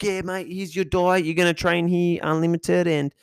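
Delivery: hammed-up bro trainer hyping a new client; lightly voiced Aussie gym bro; gym trainer impression. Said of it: "He's like".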